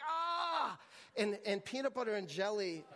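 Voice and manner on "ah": retold pain cry. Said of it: "I'm like".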